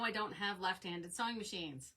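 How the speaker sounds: ordinary talk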